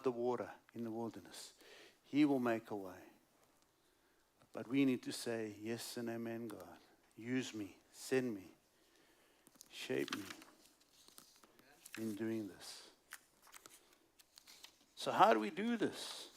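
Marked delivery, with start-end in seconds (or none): 5.6-12.9 softer, lower, prayerful surrender; a gentle, hushed yes to God. Pretend. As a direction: pray it quietly to God.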